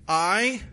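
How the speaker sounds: I punched high and loud; stress on I. Shown as a demonstration. Preacher stressing "I" as the Hebrew does. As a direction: hit the word "I" hard.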